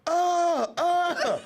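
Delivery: high, loud faked grunts; imitated grunts of William getting whooped; mock grunts. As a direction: yelp like someone taking a punch. Imitating William being beaten.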